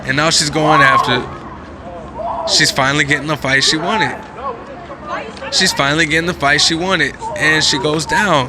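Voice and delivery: loud, excited play-by-play; excited commentary. Real, not acted. Reacting to the fight video, loud and excited. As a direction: call it as it happens.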